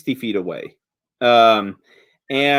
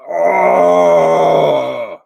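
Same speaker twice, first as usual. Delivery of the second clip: giant's surprised oh; 2 semitones higher, 7 dB louder than usual. Acted. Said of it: "he looks at the group and he says".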